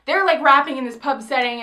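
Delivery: ordinary delivery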